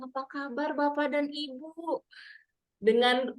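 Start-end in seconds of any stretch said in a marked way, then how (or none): 0.1-2.0 softer, plainer greeting. Shown as a demonstration. MC greeting an audience, lightly voiced. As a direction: ask the room how they are. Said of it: "dengan ratusan atau ribuan".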